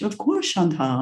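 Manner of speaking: high plummy "Of course"; posh English accent, lifted pitch on course; mock upper-class English accent; posh British father. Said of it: "'Cause he's very posh".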